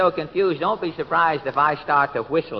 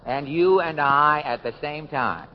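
radio announcer; 6 semitones higher than usual. Acted. Host introducing the next guest, announcer voice. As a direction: proclaim it to the studio audience.

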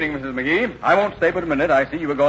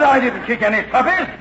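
higher-pitched, exasperated denial; indignant protest; 6 semitones higher than usual. Acted. Mayor wrongly accused of kicking puppies, indignant and higher.

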